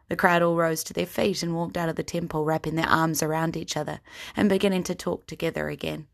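ordinary talk

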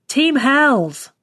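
high-pitched toast call; rousing team cheer; rallying cry. A narrator's reading. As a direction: call it out to rouse the crowd. Drunken rallying cry at a wake.

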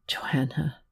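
whispered name, voice breaking; soft, broken whisper of her name; voice cracking. A narrator's reading. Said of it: "His voice cracked again".

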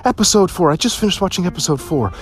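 breathless high-pitched rave about the show; gushing mother. Retold or imitated. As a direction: gush about the show like an over-thrilled mom. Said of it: "And she tells me".

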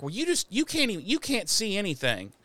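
high, hurried retort; mock arguing. Voicing Willow arguing back at Giles, high exasperated retort. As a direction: imitate a frustrated comeback mid-argument.

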